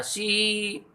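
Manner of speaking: सी stretched long, softly held; stressed syllable demo. Shown as a demonstration. Teacher repeating the stressed syllable, long soft सी. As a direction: sustain सी softly, lengthening the vowel. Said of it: "इस पे हमें ज़्यादा जोर लगाना पड़ रहा है".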